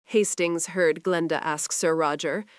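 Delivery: ordinary delivery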